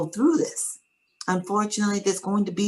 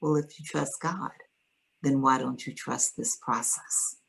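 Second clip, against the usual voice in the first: very calm; 3 semitones lower, 6 dB quieter than usual. Retold or imitated. Social worker answers his anger, very calmly. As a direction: soothe him, unhurried. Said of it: "very calmly".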